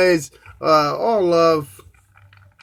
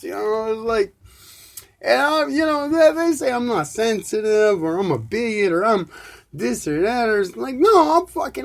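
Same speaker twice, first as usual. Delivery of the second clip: loud, high, heated cursing rant; angry rant; 7 semitones higher, 4 dB louder than usual. Real, not acted. Ranting at listeners about the gender debate, heated and loud. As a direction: rip into them, voice climbing to near yells. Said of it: "that's my twenty-five minute fucking rant".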